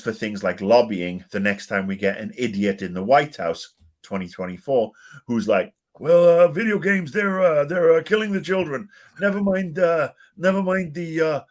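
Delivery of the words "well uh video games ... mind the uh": high, loud, hammed-up American politician; mock an alarmed American in a terrible accent; American accent put on badly, pitched way up. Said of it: "me doing the worst American accent".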